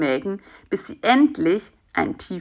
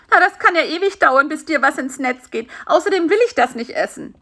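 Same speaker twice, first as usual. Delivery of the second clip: higher, mildly put-out complaint; lightly voiced impatient fly; 8 semitones higher, 4 dB louder, faster than usual. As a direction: grumble lightly in a raised character voice.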